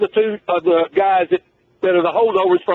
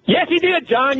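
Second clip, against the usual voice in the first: emphatic insistence; 7 semitones higher, slower than usual. Real, not acted. Host insists against producer's denial.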